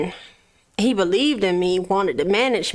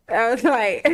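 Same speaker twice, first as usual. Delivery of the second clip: breaking into laughter mid-phrase; laughing; 7 semitones higher than usual. Real, not acted. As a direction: start talking, then crack up. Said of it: "I'm laughing 'cause I'm finna".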